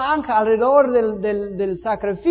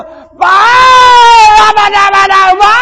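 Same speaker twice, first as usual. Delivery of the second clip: alaridos muy agudos y fuertes; gritos a Baal; 12 semitones higher, 15 dB louder, much slower than usual. Acted. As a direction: grita el nombre desesperado como profeta. (imitando a gritos desesperados a los profetas de Baal)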